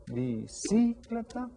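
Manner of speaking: "Bicicleta" as bi-CI-cleta, CI held high; stress on second syllable. Shown as a demonstration. Teacher tests which is stressed, CI accented in "Bicicleta". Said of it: "which one is stressed?".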